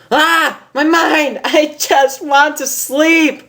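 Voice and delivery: very high, loud, slow wail; frustrated outburst. Acted. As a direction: cry out in exasperation at her own mind.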